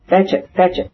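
high-pitched urgent call; shouted. A narrator's reading. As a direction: call the dog sharply. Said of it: "shouted Levin".

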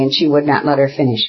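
usual speaking voice

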